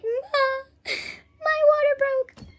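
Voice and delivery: very high, loud, stretched-out cry; hammed-up wail for mom; mock panicked wail.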